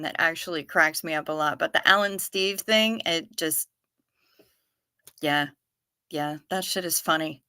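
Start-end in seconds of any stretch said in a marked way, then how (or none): none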